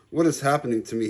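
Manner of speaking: plain reading voice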